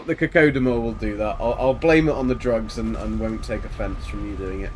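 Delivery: normal talking voice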